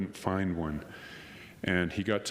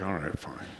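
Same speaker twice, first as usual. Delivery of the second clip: low, mildly mock-resigned giving in; grudging agreement; 4 semitones lower, 4 dB quieter than usual. Waiter giving in to the persistent customer, mock grudging. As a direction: give in reluctantly, dropping the pitch.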